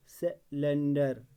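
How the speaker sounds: Cylinder slow, with a gap after se; syllable by syllable. Shown as a demonstration. Teacher after spelling it with dots, syllable by syllable. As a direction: break cylinder into se, len, dar. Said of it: "तीन syllable में divide होता है".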